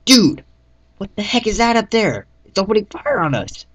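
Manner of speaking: high, loud shout of alarm; panicked yell. Acted. Lone yells at the squad about the gunship, panicked. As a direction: scream it at the sky as bullets hit. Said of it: "asked Lone".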